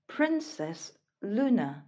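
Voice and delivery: plain reading voice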